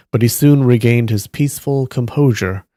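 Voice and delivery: usual speaking voice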